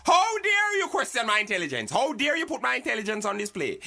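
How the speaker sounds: furious at the insult to his mind; outraged. Acted. Host answering the guest's insult, outraged shouting.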